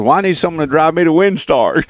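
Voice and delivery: high, put-on little old lady voice; imitating old lady neighbor. Host quoting his neighbor asking for a casino ride, high old lady voice. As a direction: ask like a sweet old lady wanting a ride.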